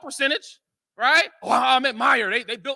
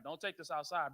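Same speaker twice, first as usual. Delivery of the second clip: ordinary talk, nothing marked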